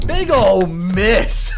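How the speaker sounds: high, loud, drawn-out call; slow, stretched-out triumphant call; gleeful announcement. Real, not acted. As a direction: crow over the failed attack. Announcing a failed enemy attack gleefully.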